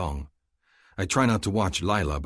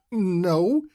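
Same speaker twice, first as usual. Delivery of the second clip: high laughing female voice, breaking into a laugh; laughing loudly; 5 dB louder than usual. A narrator's reading. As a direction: laugh it out, then a bright no. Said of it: "She laughs loudly".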